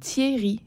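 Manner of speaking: "Thierry" softly said as "Tié-ri". Shown as a demonstration. Colleague answers host's question, "Thierry" as "Tié-ri". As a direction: sound the E after the I in "Thierry", softly. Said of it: "Il y a un E".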